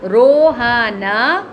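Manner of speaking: drawn-out teacher reading, very slow; slow, clear demo of the word; slow model reading. Shown as a demonstration. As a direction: stretch each sound for the kids. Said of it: "यहाँ पहचानिए".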